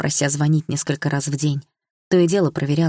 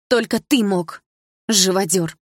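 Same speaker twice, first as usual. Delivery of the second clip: loud bitter accusation, higher; angry accusation; 3 semitones higher, 4 dB louder, slower than usual. A narrator's reading. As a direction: spit the insult at the stepfather. Daughter accusing stepfather. (дочь обвиняет отчима, зло)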